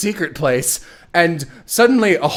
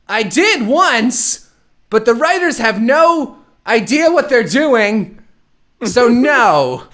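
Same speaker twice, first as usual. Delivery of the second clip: higher, slow, deadpan brush-off; mock Edward; 6 semitones higher, slower than usual. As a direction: dismiss her lazily, dragging it out. Voicing Edward's deadpan reply.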